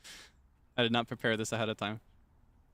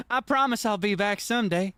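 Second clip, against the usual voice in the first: mock Southern-tinged accent; 6 semitones higher, 5 dB louder than usual. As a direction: promise roses like a Southern belle in an old film. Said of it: "it's almost Southern".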